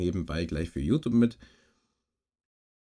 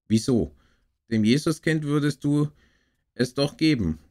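mildly raised, higher-pitched protest; called out; 7 semitones higher, 5 dB louder than usual. A narrator's reading. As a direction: call it out as a child's objection. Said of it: "ruft Gerold". (leicht erhobener, rufender Einwand)

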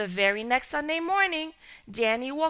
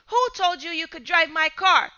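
angry yell; 5 semitones higher, 4 dB louder than usual. Acted. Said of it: "screaming at the boys".

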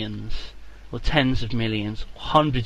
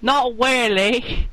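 high, slow fake Chinese accent; a slow, high put-on Chinese accent; 10 semitones higher, much slower than usual. Pretend Chinese farmer gives his name, fake Chinese accent. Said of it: "I can't do the accent".

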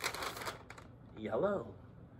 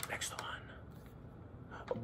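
the second "Next one." whispered, unvoiced and much softer; 25 dB quieter than usual. Shown as a demonstration. Repeats announcement as whisper.

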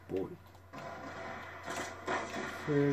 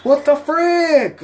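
high, slow, loud drawn-out yell; real furious yell; 12 dB louder, slower than usual. Real, not acted. Gamer screaming at his game, real furious yell. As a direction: scream the question at the screen. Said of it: "I'm getting so pissed off".

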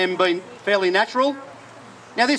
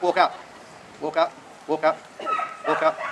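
low dog command; 6 semitones lower, 5 dB quieter than usual. Real, not acted. Calling commands to working dog.

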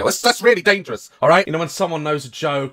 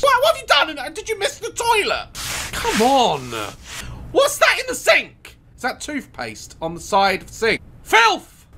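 shrill outraged cleaner; outraged at the mess; disgusted cleaner; 8 semitones higher than usual. Pretend.

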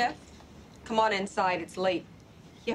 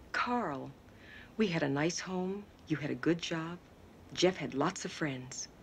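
lower, softer, measured pleading; subdued reproach; 6 semitones lower, 6 dB quieter than usual. Acted. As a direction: quietly list what was lost. Wife pleads amid marital quarrel.

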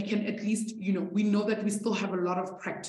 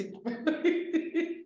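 sudden high laugh that breaks out; real laugh she can't hold back; burst of laughter; 8 semitones higher, 5 dB quieter than usual. Real, not acted. Teacher laughing at a student's comment, high laugh. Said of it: "I didn't mean to laugh".

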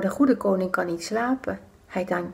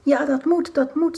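higher, eager insisting; gloating wicked men; 6 semitones higher than usual. Acted. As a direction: press the point with smug glee. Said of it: "zeggen die boze mannen". (de boze mannen, triomfantelijk)

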